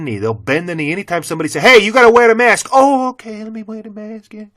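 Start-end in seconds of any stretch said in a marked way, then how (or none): 1.6-2.7 high, loud sneering nag voice; whiny mimicry of a mask enforcer; mocking mask nag. Ranting vlogger mocks a store clerk's mask demand, high loud sneer. 2.7-4.5 high, whiny mock pushover; a limp, simpering mock yes; mock meek compliance. Vlogger mimics people who meekly obey mask rules, high whiny mock.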